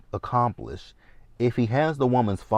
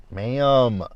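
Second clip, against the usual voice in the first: drawn-out ma'am; 3 semitones higher than usual. Real, not acted. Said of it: "reacting to tracker".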